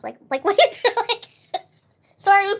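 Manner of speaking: laughing. Real, not acted. Laughing at her own blunt question to a friend. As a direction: laugh through the apology.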